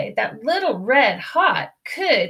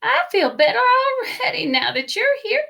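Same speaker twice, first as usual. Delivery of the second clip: put-on old grandma welcoming; 13 semitones higher than usual. Grandma voiced answering.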